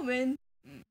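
ordinary talk

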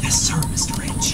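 whisper. Acted. Servant whispers reply to master.

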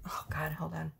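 hushed, breathless aside; whispered aside. Real, not acted. Reader muttering to herself while sorting cards, whispered. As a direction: drop to a whisper to yourself.

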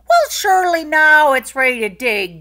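loud, high eager Irishman; eager to dig; eager Jamie. A narrator's reading. Jamie eager to dig up the huge potato, loud.

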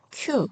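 큐 short and high with a puff; aspirated 큐. Shown as a demonstration. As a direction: release the k of 큐 with air. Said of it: "이렇게 읽으시면 되시고요". (거센소리로 짧고 높게 큐)

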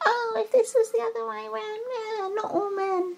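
high, whiny mock complaint; hammed-up high-pitched whinge; mock whining. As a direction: whine it like an aggrieved complainer. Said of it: "I can almost hear the whining now".

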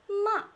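"ma" falling sharply from high; fourth tone. Shown as a demonstration. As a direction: drop "ma" quickly from a high start. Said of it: "Fourth tone".

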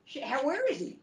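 high shocked outburst; alarmed. Real, not acted. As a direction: shout in disbelief. Sudden confusion in fight.

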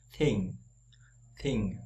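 Thỉnh with a light breathy th, tongue between teeth; English th as in think; soft TH. Shown as a demonstration. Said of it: "âm thờ nhẹ".

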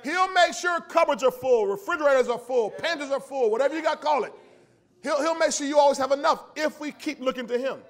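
loud, slow, drawn-out pulpit reading; preaching at full force. Acted. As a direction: proclaim the verse to the congregation. Preaches the psalm aloud.

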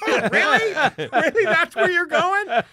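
high, loud, drawn-out disbelief through laughter; mock incredulity. Comic challenging his friend's pick, mock incredulous. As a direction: play up astonishment, slowly and loudly.

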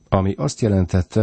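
plain reading voice

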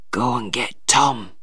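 low, soft, weakened request; ailing. A narrator's reading. Sick father sends for his son, weakly. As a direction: ask weakly, voice sunk.

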